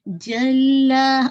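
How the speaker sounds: جلا with a firm j, no escaping air; very slow جلا, long doubled l; airless jeem demo. Shown as a demonstration. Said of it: "ایئر کو نہیں نکلنے دیجیے". (بغیر ہوا کے جیم)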